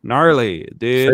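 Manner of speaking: hint of a put-on American surfer accent. Shown as a demonstration. Brit asked by co-host to perform, put-on American accent. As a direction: lightly fake a Californian dude. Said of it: "do your American accent again".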